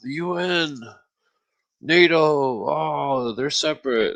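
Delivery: a hint of mimicked youngster; lightly mocked youngsters. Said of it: "there's all these youngsters running around".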